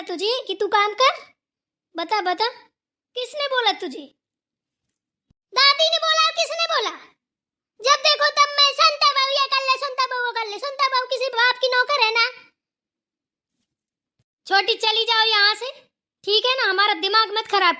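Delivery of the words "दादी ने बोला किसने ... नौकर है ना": mocking grandma. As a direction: parody the grandmother bossing the daughter-in-law. Mocking grandmother's constant orders.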